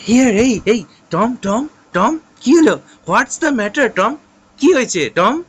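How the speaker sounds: high, loud frightened pleading. Acted. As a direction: shake him awake in alarm. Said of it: "সিড ভয় খেয়ে next বলছে".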